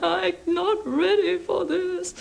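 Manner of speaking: high, drawn-out wail of retold tears; imitating her own weepy outburst; mock tearful wail. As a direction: wail it like an overwhelmed beginner, half laughing. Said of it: "just burst into tears".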